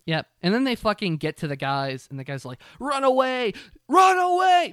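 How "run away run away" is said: high panicked shout, rising and louder; hammed-up terrified yell; screaming run away. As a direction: scream it like you're being eaten. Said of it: "They're screaming".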